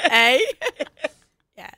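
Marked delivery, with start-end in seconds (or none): 0.1-0.4 loud mock-Canadian "eh"; Canadian accent imitation. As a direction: punch out a jokey Canadian "eh". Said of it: "Canadians have a different accent".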